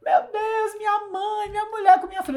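high, wailing plea retold in a crying voice; mock sobbing plea. Prisoner crying to the reporter about his family, high wailing plea. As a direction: imitate a prisoner breaking into tears, pitched high. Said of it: "Alguns abriam um berreiro".

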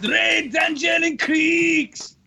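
high, growly ring announcer call; hammed-up growling name announcement; growled announcer intro. Shown as a demonstration. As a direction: growl the names out like a wrestling hype man. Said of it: "the little growl to it".

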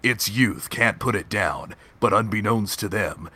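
low, dramatic trailer announcer; movie trailer voice. Acted. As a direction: narrate it like an epic movie trailer. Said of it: "movie trailer voice".

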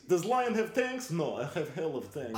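put-on Russian accent. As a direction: brag in a thick Russian accent. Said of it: "He's like".

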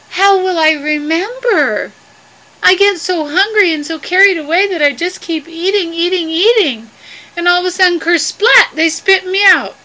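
high, plaintive character voice; fretful worry. Acted. Mel worrying to Inchi he will forget, fretful. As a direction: fret up high, building toward the splat. Mel frets about remembering.

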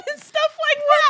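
high, loud laughing giggle; cracking up while speaking; squealing with laughter. Real, not acted. Laughing after the quote.

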